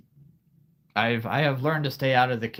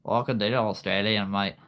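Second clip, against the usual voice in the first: Australian accent. Shown as a demonstration. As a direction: put on a broad Australian accent. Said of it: "I can do a pretty good accent there".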